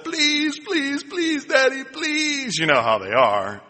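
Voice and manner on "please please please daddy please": put-on high pleading little boy voice; child begging. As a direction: beg like a small son for the slide. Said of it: "But Paul said".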